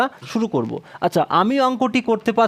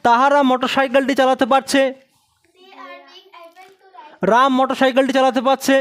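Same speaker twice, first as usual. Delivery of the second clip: usual speaking voice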